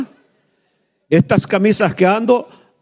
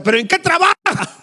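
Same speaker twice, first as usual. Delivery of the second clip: high, mock-insistent teacher's question; pressing teacher; 13 semitones higher than usual. Pastor voicing the teacher pressing his daughter, high mocking voice. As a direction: press the child like a pushy teacher.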